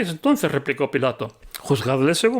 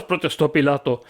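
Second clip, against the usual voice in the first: ordinary delivery, nothing marked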